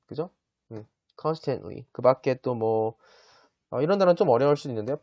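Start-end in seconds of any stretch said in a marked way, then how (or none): 1.2-1.8 constantly with the final t dropped, very slow; constantly with a near-silent t; silent t pronunciation. Shown as a demonstration. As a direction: say constantly slowly, swallowing the t before ly. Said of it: "여기 끝에 있는 T 발음 있죠".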